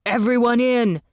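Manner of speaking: loud urgent call into the tunnel; urgent shout to everyone. A narrator's reading. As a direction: yell it over falling rock. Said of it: "he shouted".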